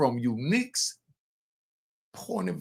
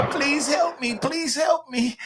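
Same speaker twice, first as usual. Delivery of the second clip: mock panicked scream for help, pitched way up; mock screaming plea; 13 semitones higher, slower than usual. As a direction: ham up a panicked cry for help. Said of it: "he made [name] scream".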